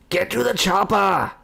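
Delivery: booming slow action hero shout; action hero movie quote. Pretend.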